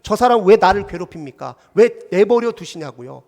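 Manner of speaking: loud, indignant plea to God; acted protest, voice thrown out loudly; pouring out hurt. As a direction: demand answers from God in an aggrieved outburst. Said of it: "하나님 앞에 막 쏟아붓는".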